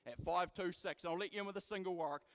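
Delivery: rapid rhythmic auction chant, punching prices; auctioneer. Acted. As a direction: rattle off bids like a livestock auctioneer. Live cattle auction bid calling.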